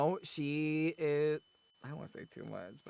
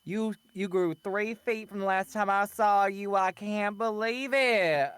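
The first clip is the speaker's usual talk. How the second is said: much higher, louder, slow gushing deep South relative; deep South accent; 7 semitones higher, 5 dB louder, slower than usual. Retold or imitated. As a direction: gush like a deep South aunt. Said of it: "sometimes that accent will come out".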